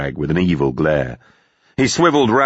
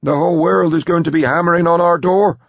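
put-on high, loud, crowing old man; gleeful; 13 semitones higher, 5 dB louder, faster than usual. Philip Evans foreseeing a rush of buyers, gleeful. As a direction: crow it with glee. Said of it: "said gleefully".

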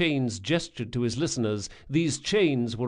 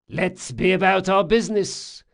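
higher, louder character voice; thief-maker's voice; 8 semitones higher, 7 dB louder than usual. A narrator's reading. Thief-maker hurrying young Locke along, higher and louder. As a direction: call them to business as the thief-maker.

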